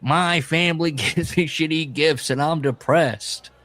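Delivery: mock a self-pitying rant; mock complaint. Mocks the ranter's complaint.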